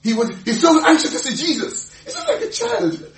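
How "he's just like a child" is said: high amused laugh through the words. Real, not acted. Preacher laughing at childlike Peter, high giggling voice. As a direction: giggle as you say it.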